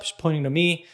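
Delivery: ordinary talk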